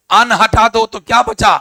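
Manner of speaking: ordinary delivery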